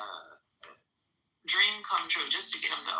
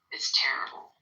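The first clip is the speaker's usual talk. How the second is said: whispering; 4 semitones lower than usual. Real, not acted. Whispered lament.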